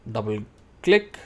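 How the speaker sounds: usual speaking voice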